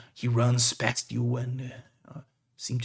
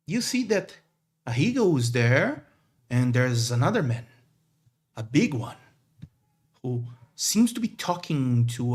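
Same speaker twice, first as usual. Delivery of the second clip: usual speaking voice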